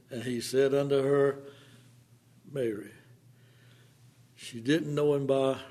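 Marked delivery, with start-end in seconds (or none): 2.5-2.9 tender, soft naming; a quiet tender Mary. A narrator's reading. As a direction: say her name gently, like a call she knows. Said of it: "she knew him by his voice, speaking her name".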